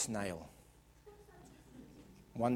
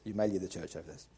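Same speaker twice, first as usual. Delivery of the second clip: faster hushed aside, half laughing; quick teasing repeat; 8 semitones lower, 8 dB quieter, faster than usual. Acted. Preacher hurrying a confession to the congregation, quick and low. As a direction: rattle it off as a joke. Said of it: "Now this, okay".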